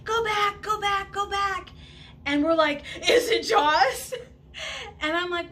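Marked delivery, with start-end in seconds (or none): 0.0-1.7 screaming warning. Retold or imitated. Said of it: "I hear them screaming". 3.1-5.0 high, loud, half-laughing fright; scared laughing question. Retold or imitated. Boys screaming warning.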